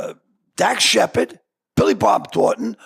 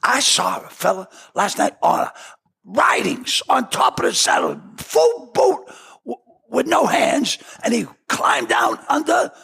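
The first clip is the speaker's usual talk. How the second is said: awestruck storyteller, higher pitched; Robert Duvall; 7 semitones higher than usual. Retold or imitated. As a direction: play the actor marvelling at a stunt. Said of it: "He says".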